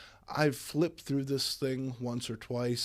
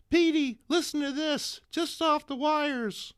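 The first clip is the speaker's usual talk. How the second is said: put-on much higher, breathless excited girl voice; breathless young woman; 6 dB louder than usual. MJ rushing in to tell Peter news, high breathless girl voice. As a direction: gush the news in a high girlish voice. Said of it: "rushes in, breathless".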